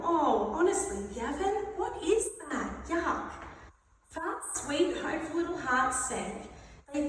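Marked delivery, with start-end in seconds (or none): 0.0-3.3 high-pitched disgusted scolding; exasperated disgust. Acted. Customer to Gavin in the cafe, exasperated disgust. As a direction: scold him for the stink.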